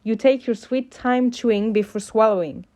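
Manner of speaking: ordinary delivery, nothing marked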